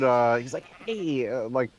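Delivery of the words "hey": lightly impersonated voice, higher pitched; a higher, mild celebrity impression; Richard Horvitz. Retelling being greeted by the voice actor, lightly imitated. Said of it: "a really good Richard Horvitz impression".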